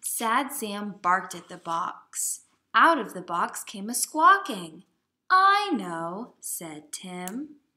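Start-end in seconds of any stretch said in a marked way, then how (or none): 2.7-4.8 loud. A narrator's reading. Story excitement. 5.3-6.3 excited exclaim. Acted. Character exclaims in story.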